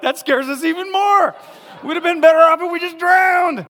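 high loud panicky shout; panicked disciples. Retold or imitated. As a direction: wail it like a terrified sailor. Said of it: "They're like".

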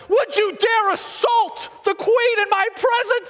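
high, slow, shouted outrage; enraged king. Acted. King catches Haman too close to queen, enraged shout. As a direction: thunder it with hammed-up royal fury. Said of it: "the king is so enraged".

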